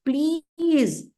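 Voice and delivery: "Please" stretched out high, then falling; stress on Please. Shown as a demonstration. Teacher quoting the sages' request, emphatic "Please". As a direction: hold "Please" long and lifted as the key word. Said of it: "Why we have to stress on each word".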